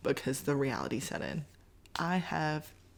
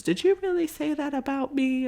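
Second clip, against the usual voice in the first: much higher, louder mock-offended doctor voice; teasing doctor; 11 semitones higher, 5 dB louder than usual. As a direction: play the doctor pretending to be hurt.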